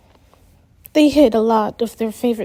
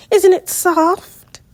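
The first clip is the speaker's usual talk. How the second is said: slow, higher and louder gushing; delighted slow gush over a sweater; 8 semitones higher, 5 dB louder, slower than usual. Acted. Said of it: "Meredith gushed".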